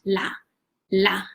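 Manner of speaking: la said low and held alone; syllable drill. Shown as a demonstration. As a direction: repeat "la" as in the recording. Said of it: "when they say la".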